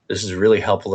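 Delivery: usual speaking voice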